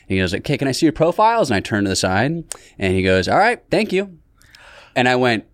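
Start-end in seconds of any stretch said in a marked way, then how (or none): 0.3-1.5 high-pitched imitation of a casting director; mock casting director. Acted casting director in retold audition. 3.3-4.2 high-pitched imitation of a brush-off; mock dismissive casting director. Acted casting director dismissing him.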